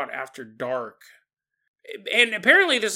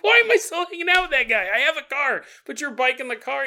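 higher, fast exasperated rant; higher-pitched, exasperated friend offering a ride; 8 semitones higher, 4 dB louder, faster than usual. Pretend. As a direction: vent at the absurd bike exit.

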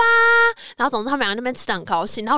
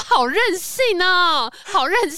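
mock complaint; 8 semitones higher, slower than usual. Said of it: "他说".

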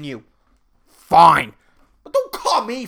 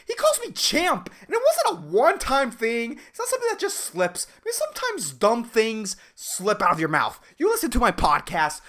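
ordinary talk, nothing marked

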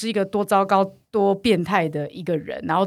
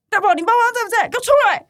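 high, loud furious mother; angry mother yelling; 14 semitones higher, 4 dB louder, faster than usual. Retold or imitated. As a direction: shout at the kid like an outraged mom. Angry mother summons daughter. (愤怒的妈妈高声吼叫)

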